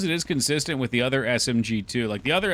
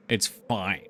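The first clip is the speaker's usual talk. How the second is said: clipped falling it's fine; passive aggressive; 4 semitones lower than usual. Shown as a demonstration. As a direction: say it like it isn't fine. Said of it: "in a super passive-aggressive tone".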